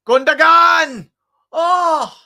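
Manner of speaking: a sudden high, loud, stretched yell of the name; near-miss shout. Real, not acted. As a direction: leap out of your seat as the shot flies wide.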